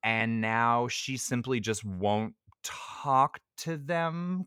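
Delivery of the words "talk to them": THEM lifted high like a question; questioning uncertainty. Real, not acted. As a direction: raise THEM into an unsure question. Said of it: "question mark".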